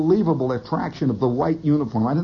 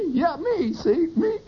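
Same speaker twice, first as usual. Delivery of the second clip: high, flustered protest; flustered disbelief; 11 semitones higher, slower than usual. Retold or imitated. Man mistaken for a doctor by a nurse, flustered. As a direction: squeak it out, caught off guard.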